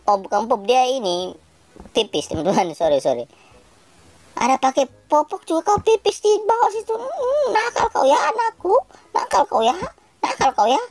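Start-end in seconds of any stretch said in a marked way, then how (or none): 4.4-10.8 put-on high teasing mother scolding her baby; mock scolding mama. Mama scolding her baby for peeing, high teasing voice. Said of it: "Mama capek ini".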